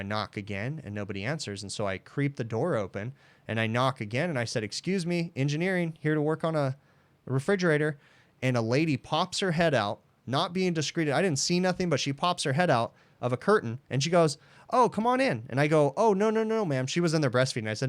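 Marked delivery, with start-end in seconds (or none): 4.6-8.0 raised, higher polite call through a closed door; knocking, then a higher, polite call of who you are. Retold or imitated. 14.7-15.5 much higher, breezy lady's voice; lady in the lactation room, imitated high and casual; mock casual lady. Breastfeeding lady voiced in story. 16.0-16.9 high, rushed flustered no's; embarrassed repairman at the door, high and flustered; flustered refusal. Retold or imitated.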